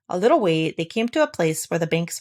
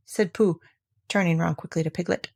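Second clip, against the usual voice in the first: plain reading voice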